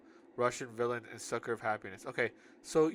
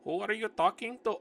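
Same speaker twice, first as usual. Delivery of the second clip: put-on high-pitched Indian-accented voice; 8 semitones higher, 4 dB louder than usual. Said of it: "I'm gonna give her Indian voice".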